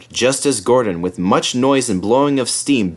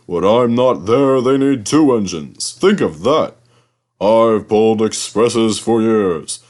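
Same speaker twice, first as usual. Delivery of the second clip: proud Gordon. A narrator's reading. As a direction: puff yourself up, boastful big engine. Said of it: "said Gordon proudly".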